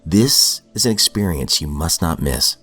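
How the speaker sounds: normal talking voice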